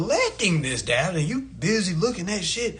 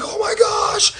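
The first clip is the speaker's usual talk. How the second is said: shrill high mock panic, laughing through it; mock panicked screaming; 13 semitones higher, 4 dB louder, slower than usual. Uninformed people screaming about a bank crash, mock panic. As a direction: scream like the world is ending, half laughing. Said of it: "always gonna be screaming".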